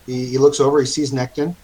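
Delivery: ordinary talk, nothing marked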